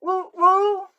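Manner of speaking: very high, loud whoops; mock fan whooping in church.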